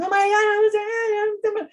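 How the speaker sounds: put-on high, slow exasperated teen wail; mock overwhelmed teen. Teen flailing at parent, high and slow. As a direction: play the flustered teen who can't find words. Teen unable to describe feelings.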